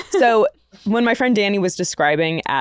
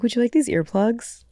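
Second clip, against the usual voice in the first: put-on British accent; 4 semitones higher than usual. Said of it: "But with a British accent".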